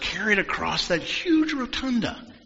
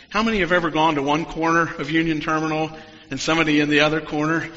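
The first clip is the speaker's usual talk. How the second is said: ordinary talk, nothing marked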